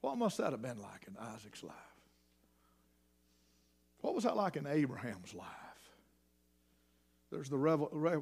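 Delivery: usual speaking voice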